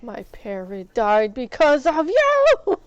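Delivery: loud, slow accusation; yelling at the modder over the dead parrot; mock accusing shout. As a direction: blame him, loud and dragged out.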